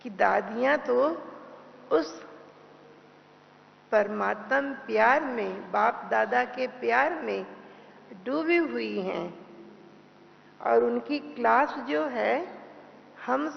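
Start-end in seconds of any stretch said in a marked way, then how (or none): none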